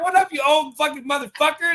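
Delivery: high, loud matey greeting; mate's greeting. Retold or imitated. Greeting a best mate with a term of endearment, high and loud. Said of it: "I'd be like".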